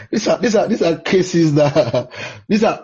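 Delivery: talking through real laughter; laughing. Real, not acted. Preacher amused by listener's reaction, laughing while talking.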